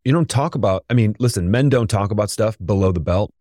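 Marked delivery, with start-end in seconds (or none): none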